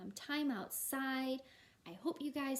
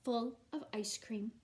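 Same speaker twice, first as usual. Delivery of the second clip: put-on lively, louder storybook reading for kids; reading to children; 5 dB louder than usual. A narrator's reading. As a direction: read it out like a teacher at story time. Reads picture book to class.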